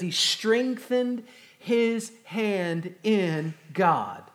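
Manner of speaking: slow emphatic reading. Real, not acted. Climax verse read slowly.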